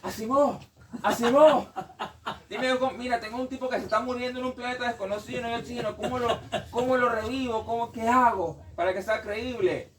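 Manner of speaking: high, loud call for help; hammed-up urgent appeal; mock desperate plea. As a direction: act out a frantic writer begging a friend. Acts author pleading with Asimov for help.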